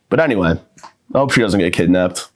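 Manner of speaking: ordinary talk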